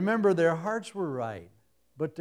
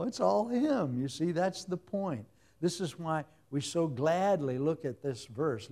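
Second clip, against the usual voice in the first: ordinary talk, nothing marked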